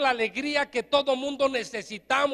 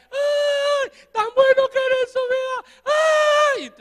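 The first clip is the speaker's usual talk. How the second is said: high, loud hammed-up wailing; mock mourner wailing; 12 semitones higher, 6 dB louder, slower than usual. Preacher mimics hired mourners wailing, high mock wail. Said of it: "empezaban a llorar".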